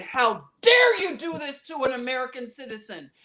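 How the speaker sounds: loud, indignant scolding; angry telling off. Retold or imitated. Woman scolding TSA agents, loud and outraged. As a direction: shout them down for shaming the man. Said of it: "I said".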